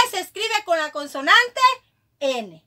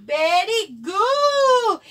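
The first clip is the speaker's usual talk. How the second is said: excited praise; 3 semitones higher, much slower than usual. Real, not acted. Teacher praising child's reading. (elogio entusiasta y alargado)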